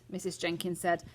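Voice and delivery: usual speaking voice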